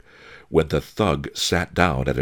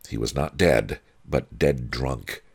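plain reading voice

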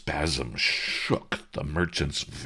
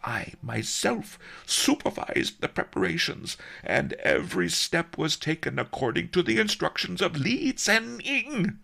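high, indignant self-defence; proud master, slowing grandly on the last words; affronted old master; 3 dB louder than usual. A narrator's reading. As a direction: declare it with wounded dignity, pitch up.

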